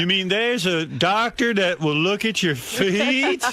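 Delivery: slow, high, laughing disbelief; mock amazement. Host mocking Richard's discovery of doctors, amazed and laughing. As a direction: play the amazed caveman, laughing. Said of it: "Like, the".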